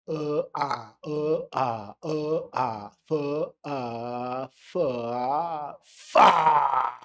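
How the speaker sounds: alternating uh and ah vowels; switching between fuh and fa; practising uh then ah; vowel drill. Shown as a demonstration.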